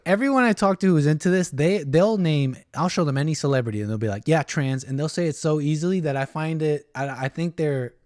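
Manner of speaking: usual speaking voice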